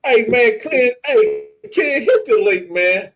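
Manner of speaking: loud, high call-out. Retold or imitated. Challenging Clint to fight, loud call. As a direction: yell across at Clint to challenge him. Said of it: "That's why y'all think".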